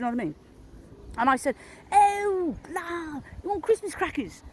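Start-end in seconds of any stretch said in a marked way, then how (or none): 1.9-4.4 high, drawn-out mock dismay; hammed-up penny-dropping cry, pitched way up; exaggerated realisation. Shop worker realising she wants Christmas crackers, mock astonishment.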